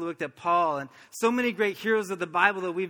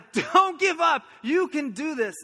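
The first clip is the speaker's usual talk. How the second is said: raised, higher coaching yell that eases off; 8 semitones higher, 4 dB louder, slower than usual. Retold or imitated. Coach running the infield cheering on runner, raised yell. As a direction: urge him on, loud at first. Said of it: "yelling at me".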